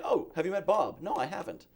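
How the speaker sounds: ordinary delivery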